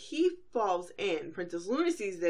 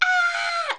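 a short, much higher, louder ah; a startled pony squealing; cartoon scream; 8 dB louder than usual. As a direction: yelp and bolt. Pony screaming before running away.

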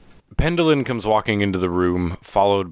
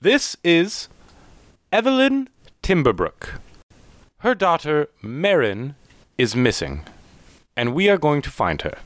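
higher, measured character voice; higher-pitched, deliberate introduction with pauses; Pendleton; 7 semitones higher than usual. Acted. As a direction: announce it formally in a raised character voice. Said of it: "Pendleton says".

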